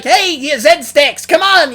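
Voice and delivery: very high, loud and slow shouted taunt; high-pitched Captain Zero. Retold or imitated. Captain Zero calling out the Zed Stacks, high-pitched shout. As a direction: yell it up high like a tiny bossy captain. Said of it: "he should be like".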